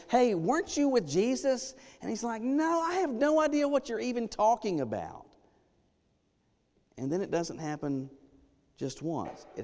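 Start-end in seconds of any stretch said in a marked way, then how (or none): 0.1-1.7 put-on high, light voice of a little girl asking; child's voice. Child questioning Peter in sermon. 2.4-5.3 high, brushing-off denial; Peter denying. Retold or imitated. Peter denying in retold scene.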